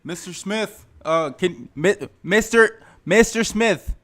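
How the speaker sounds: high, loud, slow calling; shouting to be heard. Pretend. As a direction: call out to a deaf man. Calling to deaf customer.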